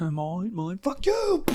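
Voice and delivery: squeaky high sassy bird snapping back; high-pitched mock seagull attitude; cocky bird voice.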